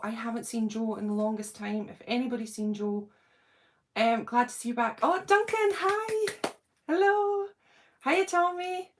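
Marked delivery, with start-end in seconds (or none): 5.0-8.9 high, bright hello to a regular; excited hello to a viewer in the chat; excited greeting. Real, not acted. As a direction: greet him like you've missed him. Greeting viewer joining live.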